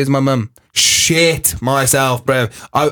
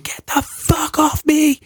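put-on high, slow shrieking tantrum of a small kid; toddler screaming; 14 semitones higher, slower than usual. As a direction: scream it like a furious toddler at his mum. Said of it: "he was screaming at his mum".

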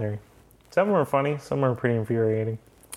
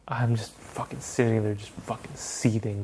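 ordinary talk

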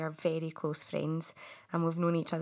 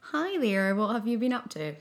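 polite host greeting, a bit higher and louder; stiff interviewer voice; 4 semitones higher, 5 dB louder than usual. Pretend. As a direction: play the formal interviewer. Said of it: "It's a bit like".

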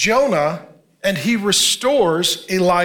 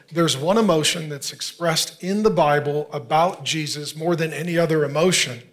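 usual speaking voice

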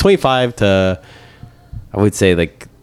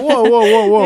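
high mock alarm; 14 semitones higher, 4 dB louder than usual. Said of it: "I was like".

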